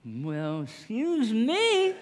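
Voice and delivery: long, high, drawn-out excuse me with a laugh; mock-offended excuse me; offended huff. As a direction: play the affronted woman, stretching the words. Woman offended, mock indignant.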